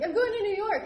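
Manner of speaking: high, loud acted desperation; retold frantic answer. Winded traveler to the airline lady, frantic and high.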